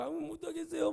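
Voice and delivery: high-pitched imitation of tearful pleading; mock wailing. Prophet refusing God's call, hammed-up tearful protest. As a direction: whine like a reluctant, weeping prophet. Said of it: "막 울고 불고".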